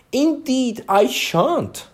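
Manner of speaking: high, impatient Alice. A narrator's reading. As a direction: brush it off with a touch of impatience. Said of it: "Alice said rather impatiently".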